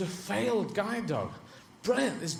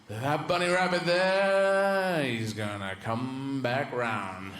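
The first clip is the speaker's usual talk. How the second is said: very slow, stretched-out words; slow-motion explanation of a cunning plan; scheming greyhound; 3 semitones lower, much slower than usual. Acted. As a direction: draw every word right out. Clever greyhound explaining his plan.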